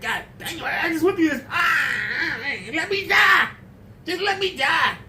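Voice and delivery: high, strained wail begging for death; high pleading cry of the damned; anguished sufferer. Pretend. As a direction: play a tormented soul who can't die. Imagined sufferer begging to die.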